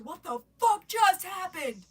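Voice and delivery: high, loud, drawn-out disbelief; re-enacted astonished yell; stunned outburst. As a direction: lean hard and slowly into the shock. Siblings' stunned reaction acted out.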